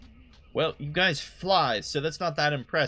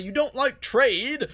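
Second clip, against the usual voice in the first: indignant shout; 10 semitones higher, 6 dB louder than usual. Acted. Mock outrage at queen.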